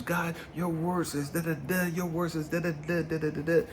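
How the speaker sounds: high-pitched retold insistence, rattled off; pleading with scripture. Man pointing God to his scriptures, insistent. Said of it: "I'm pointing to these scriptures".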